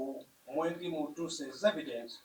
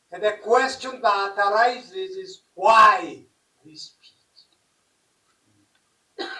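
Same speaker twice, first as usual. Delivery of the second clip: raised rhetorical question; 2 semitones higher, 7 dB louder than usual. Real, not acted.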